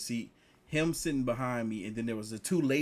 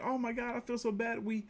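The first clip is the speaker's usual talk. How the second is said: much higher, fretting; mock guilty girls; 10 semitones higher than usual. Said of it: "the girls were talking".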